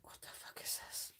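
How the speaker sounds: hushed, breathy what-the-hell; muttered aside to yourself; whispered disbelief. Retold or imitated. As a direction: whisper it like you can't believe your eyes. Baffled by eyeliner chunks.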